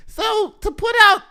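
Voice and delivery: very high, tiny squeaky voice; gymnastics voice. Acted. Host mimics girl gymnast reading her statement, squeaky little voice. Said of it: "That's my gymnastics voice".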